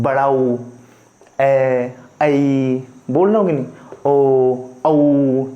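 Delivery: ऊ, ए, ऐ, ओ, औ each held long; long vowels pulled out one by one; drawn-out vowels. Shown as a demonstration. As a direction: stretch every vowel slowly. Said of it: "आवाज़ को मैं खींच कैसे रहा हूँ".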